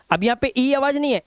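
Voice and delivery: normal talking voice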